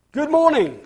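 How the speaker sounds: cheery high greeting; bright sing-song hello to a neighbour; happily. A narrator's reading. Mister Happy greets grumpy neighbour, cheerfully.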